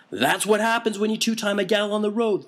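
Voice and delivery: put-on older punk woman barging into the conversation; tactless punk woman. A narrator's reading. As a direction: blurt it out with no tact. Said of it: "the woman begins tactlessly".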